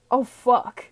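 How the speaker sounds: normal talking voice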